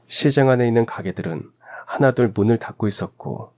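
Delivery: ordinary delivery, nothing marked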